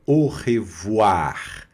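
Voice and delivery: "au revoir" ending on a raspy throat R; final R sounded. Shown as a demonstration. Teacher shows the ending, "au revoir" with its R pronounced. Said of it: "R pronuncia aqui no final".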